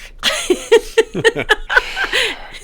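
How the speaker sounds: high bursting laugh after a curse; emotional laugh. Real, not acted. Laughing after telling his friend he loves him. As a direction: laugh it off, overwhelmed.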